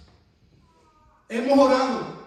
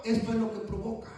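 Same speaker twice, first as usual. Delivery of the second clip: soft aside; 3 semitones lower, 9 dB quieter, faster than usual. Acted. Repeated line, soft first.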